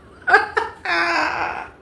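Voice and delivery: high loud laughing burst. Real, not acted. Big laugh.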